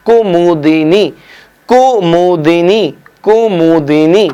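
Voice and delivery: कुमुदिनी said very slowly, syllable by syllable; slow spelling-out. Shown as a demonstration. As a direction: drag out कुमुदिनी so every syllable is clear. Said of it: "क्या बेटा?".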